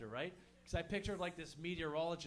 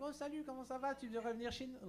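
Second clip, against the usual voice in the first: high-pitched Parisian French caricature; weird, high French-guy voice; weird Parisian dude; 8 semitones higher, faster than usual. Retold or imitated. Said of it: "high-pitched fucking Parisian dude".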